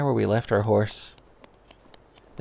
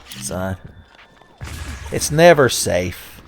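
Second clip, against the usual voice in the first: ordinary delivery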